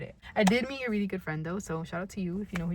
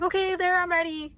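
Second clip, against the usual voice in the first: retold yell; 11 semitones higher, 3 dB louder than usual. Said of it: "Screaming at the top of her lungs".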